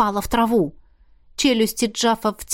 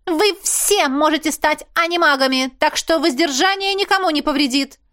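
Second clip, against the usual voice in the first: higher, haughty voice of a strict lecturer; prim teacher scolding; 7 semitones higher than usual. A narrator's reading. Marlisa tells the students off in a prim high voice. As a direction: talk down to the students.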